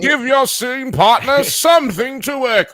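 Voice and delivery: high, loud theatrical proclamation; hammy thespian. Pretend. Celebrating a co-star's big moment, hammed-up thespian. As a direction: declaim it like an overblown stage actor. Hammed-up cheer for great acting.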